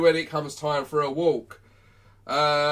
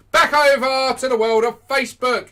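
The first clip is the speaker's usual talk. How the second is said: loud, high showman's call; announcing; 8 semitones higher, 10 dB louder than usual. Real, not acted. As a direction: hail the audience on switching platforms. Host announces switch back to Facebook.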